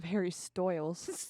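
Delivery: slow "Stoyles" with OY for long I; DJ mispronunciation. Retold or imitated. Comparing the DJ's name errors, "Stoyles" with OY. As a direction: stretch "Stoyles" to rhyme with boils. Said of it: "Why the hell is it saying Stoyles?".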